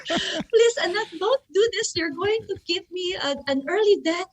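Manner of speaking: high-pitched, wailing dramatic mom; telenovela melodrama. Retold or imitated. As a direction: imitate an overwrought soap-opera mother. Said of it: "on, like, a telenovela".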